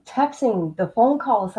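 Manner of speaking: usual speaking voice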